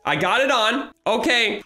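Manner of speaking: ordinary talk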